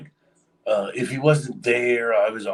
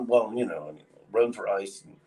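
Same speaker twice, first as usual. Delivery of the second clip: usual speaking voice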